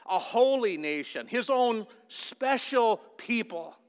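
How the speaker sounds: loud, high fiery preaching. Acted. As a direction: preach it out to the congregation. Preaching at full force.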